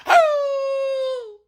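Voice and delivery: long, very high dog howl; imitated dog howl. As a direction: howl like a dog in pain, one sustained high note. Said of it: "howling, screaming".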